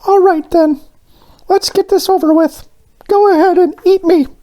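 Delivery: resigned little donut in a very high voice; muttering donut. A narrator's reading. Crushed Arnie surrenders to Mister Bing, high squeaky resignation. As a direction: give up, but keep the squeaky high character voice. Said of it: "and muttered".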